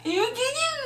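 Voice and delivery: high, crying you you you; choking up in mock despair; crying stammer. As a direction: sob out the words. Breaking down at the line.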